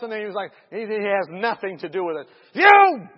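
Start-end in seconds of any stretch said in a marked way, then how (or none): 2.5-3.1 high, loud accusing shout; retold angry yell; cursing shout. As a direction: fling it out like a curse. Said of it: "he's cussing and he's cursing".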